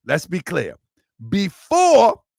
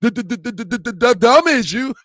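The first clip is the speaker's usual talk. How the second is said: imitating a stuttering uncle, higher pitch; mock stutter; 5 semitones higher than usual. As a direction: stutter rapidly on the first sound, then land the phrase. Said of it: "my uncle stutter".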